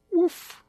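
high little bark; puppy woof. Acted. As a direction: yip like a tiny puppy.

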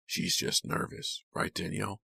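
soft, whispered question; hushed. A narrator's reading. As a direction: murmur it with quiet control. Kit's hushed aside about Danielle.

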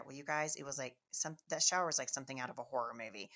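normal talking voice

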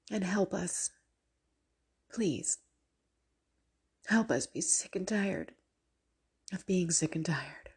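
earnest spoken prayer, rising to fervent pleas. Acted. Leading the viewers in prayer against sin, fervent prayer voice. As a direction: pray aloud with growing fervour, some lines dropping to a whisper.

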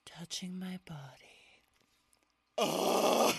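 ASMR whisper. Pretend. Parody ASMR.

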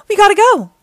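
high, loud order; urgent yell. A narrator's reading. Leo orders a getaway, urgent yell. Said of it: "yelled Leo".

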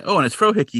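high, excited announcement; light up at the favourite's arrival; delighted excitement. Real, not acted. Host spotting Frohickey in the next scene, delighted. Hosts cheer a favourite character's entrance.

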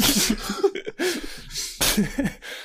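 Yoda death sound. Retold or imitated. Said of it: "Lego Yoda death sound".

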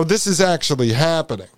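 normal talking voice

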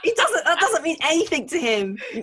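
high, loud, half-laughing disbelief; exasperated outrage. Retold or imitated. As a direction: protest at a clueless partner, laughter breaking in. Mock outrage at partner's remark.

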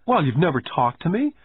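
pouting. Acted. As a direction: sulk like a child. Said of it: "I said with a pout".

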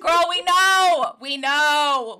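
loud, drawn-out calling out; mock exasperation. Host calling out Sam's obvious feelings, loud mock exasperation. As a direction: belt it slowly, playfully fed up.

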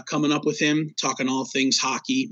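ordinary talk

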